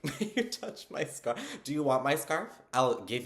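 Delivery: high, loud disbelieving gush; giddy overexcited fan. Retold or imitated. Fan offering her scarf to the author, giddy and loud. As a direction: replay the moment you lost it over a scarf.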